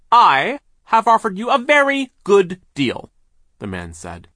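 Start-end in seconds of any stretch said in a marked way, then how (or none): 0.1-3.1 loud, high, drawn-out boss voice; big overbearing mill owner; brusque boss. A narrator's reading. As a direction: boom it out slowly and impatiently. Mill owner refuses the plea.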